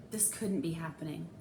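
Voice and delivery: ordinary delivery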